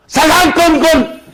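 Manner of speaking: high, loud, slow cry; preaching cry. Acted. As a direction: stretch it out fervently.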